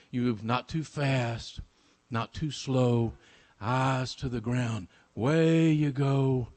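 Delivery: slow, low, spaced-out phrases; hushed, drawn-out chant-like lesson; measured instruction. Acted. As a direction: teach it step by step, unhurried. Father teaches slow hidden walk.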